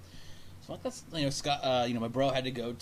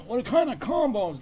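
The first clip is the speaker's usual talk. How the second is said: higher, louder, slow mimicry; mocking customer impression; 9 semitones higher, 5 dB louder, slower than usual. Complaining to a friend about the guy in line, mockingly mimicking. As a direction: parrot the customer's question mockingly, drawn out.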